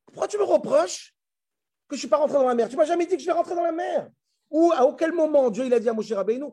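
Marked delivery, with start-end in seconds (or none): 0.1-4.1 high-pitched, acted complaint; indignant protest. Moshe protesting God's reproach, indignant. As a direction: object as one unfairly blamed, pitch raised.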